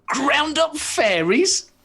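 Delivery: high, shocked squeak of disbelief. Pretend. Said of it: "conversations they wish us to roleplay".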